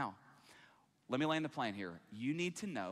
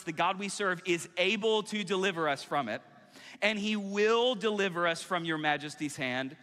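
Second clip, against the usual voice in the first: raised, ringing declaration; impassioned scripture reading; 6 semitones higher, 5 dB louder than usual. Acted. Preacher voicing three men defying the king, impassioned. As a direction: declare it boldly before a king. Reads the defiant reply to the king.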